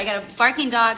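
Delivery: ordinary talk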